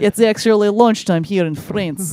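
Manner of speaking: hammed-up Russian-accented announcement; mock Russian accent. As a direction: put on a bad Russian-French accent. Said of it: "is he a Russian accent".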